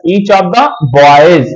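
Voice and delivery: very slow, stretched words; slow emphatic reading. Shown as a demonstration. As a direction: stretch each word for the class. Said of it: "तो ऐसे समझना बेटा".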